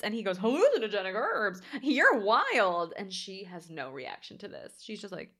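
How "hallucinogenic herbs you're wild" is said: loud, swooping mock amazement; amused disbelief. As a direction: play him tickled and incredulous, with swooping pitch. Acts out the man's amazed reaction.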